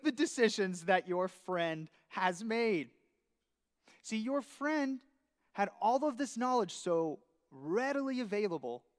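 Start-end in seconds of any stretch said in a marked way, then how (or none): none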